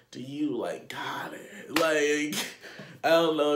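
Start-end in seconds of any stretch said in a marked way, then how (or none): 0.1-1.8 whispered aside. Retold or imitated. Asking the guy if he needs help, whispered. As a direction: whisper it, unvoiced. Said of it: "I'm like".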